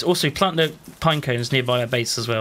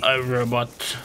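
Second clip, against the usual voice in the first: flat mock robot voice; toy robot voice; slower than usual. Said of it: "Is that your best robot voice".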